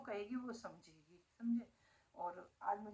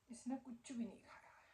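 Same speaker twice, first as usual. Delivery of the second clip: sudden hushed whisper about the dog; 6 dB quieter than usual. Real, not acted. Telling viewers the sad dog hasn't eaten, whispered. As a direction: drop to a breathy whisper, as if not to disturb her.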